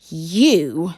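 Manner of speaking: loud, low menacing hiss; hissing dragon. Acted. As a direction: hiss it like a stalking cat. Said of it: "hissed Fireworm".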